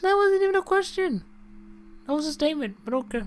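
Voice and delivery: very high, squeaky protest; squeaky indignation. Real, not acted. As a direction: pipe up, offended and incredulous. Indignant retort to game character.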